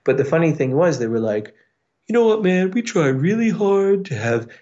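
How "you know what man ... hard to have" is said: imitating bouncers in a much higher pitch; earnest lecture. Bouncers lecture band outside the venue, earnest. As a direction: reason with them in a high, insistent voice.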